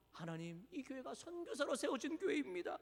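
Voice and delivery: suddenly hushed, aggrieved appeal; pleading protest. Acted. Congregation reminding God of its missionary roots, hushed protest. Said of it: "우리는 그렇게 따진다".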